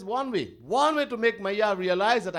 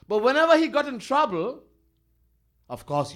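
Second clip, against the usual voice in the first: ordinary talk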